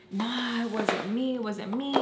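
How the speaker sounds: mock plea of innocence; imitated denial. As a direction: mimic a friend pleading innocence. Friend keeps denying the leak.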